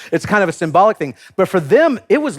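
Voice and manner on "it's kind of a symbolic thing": usual speaking voice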